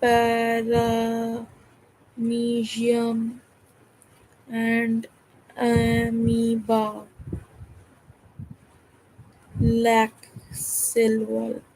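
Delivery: very slow, drawn-out syllables with long gaps; slow word-by-word reading. Real, not acted. Student reading notes to herself while copying them, slow and drawn-out. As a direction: spell it out as if dictating. Writing the words out slowly.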